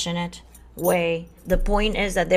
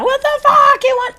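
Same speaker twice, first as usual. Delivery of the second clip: much higher, full-volume yell; screaming; 11 dB louder than usual. Shown as a demonstration. Ego screaming at the higher self in the mirror, full-volume yell. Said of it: "scream at each other in a mirror".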